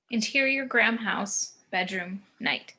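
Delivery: normal talking voice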